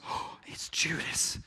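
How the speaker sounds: a breathy whispered gasp of recognition; hushed realization. Retold or imitated. Disciples recognizing the betrayer, hushed whisper. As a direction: let it dawn on them in a whisper.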